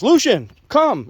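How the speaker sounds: high, loud, drawn-out dog command; imitated yell. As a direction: call the dog as a handler would, yelling. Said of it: "he yells".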